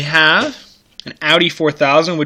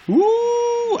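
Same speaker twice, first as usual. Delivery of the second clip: surprised ooh. Real, not acted. Spots a Plymouth Expo.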